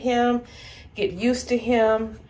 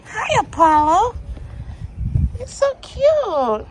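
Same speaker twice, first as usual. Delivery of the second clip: high cooing puppy talk; 9 semitones higher than usual. Real, not acted. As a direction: coo at him like an infant.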